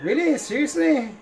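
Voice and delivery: high, disbelieving double take; really can't believe it, pitch jumps up; incredulous. Real, not acted. Gamer can't believe what the game just did, incredulous.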